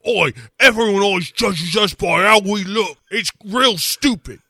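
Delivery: high whiny mock Cockney "Oi" complaint; mock British complaining.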